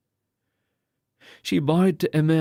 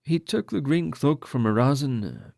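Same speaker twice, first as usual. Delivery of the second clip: ordinary delivery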